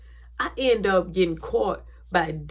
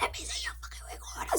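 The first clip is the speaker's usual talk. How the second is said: fast, forceful whisper; hushed, exasperated demand; urgent whisper; 13 dB quieter, faster than usual. Retold or imitated. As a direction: whisper it impatiently so nobody overhears. Urgent repeat, hiding from mom.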